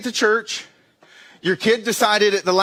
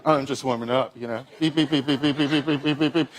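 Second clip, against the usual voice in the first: softer, lower fake-casual brush-off trailing into treadmill beeps; mock nonchalant; 6 semitones lower, 3 dB quieter, faster than usual. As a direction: pretend you're not racing the runner beside you. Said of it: "And so I'm like".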